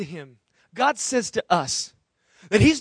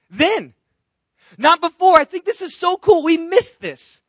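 excited; 8 semitones higher, 6 dB louder than usual. Real, not acted. Excited sermon emphasis.

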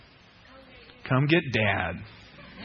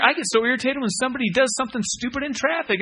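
normal talking voice

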